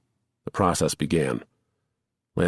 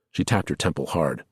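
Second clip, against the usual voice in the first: ordinary delivery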